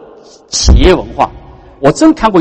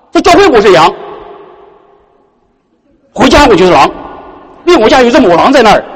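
imitating a complaining member; 7 semitones higher than usual. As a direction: play the aggrieved believer, half joking. Voices complaining church member.

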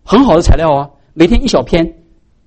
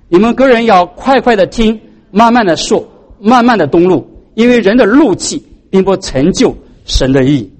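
slow, higher scripture read to the congregation; measured Bible reading; 3 semitones higher than usual. Acted. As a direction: read the verse out slowly and deliberately. Reads James 1:19-20 aloud.

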